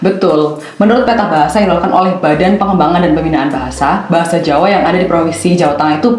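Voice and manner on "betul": normal talking voice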